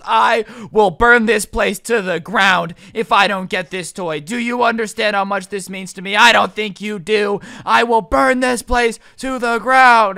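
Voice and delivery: loud, slow, word-by-word mock rage; escalating threat. Irate mom repeats her threat to the stunned manager, loud and emphatic.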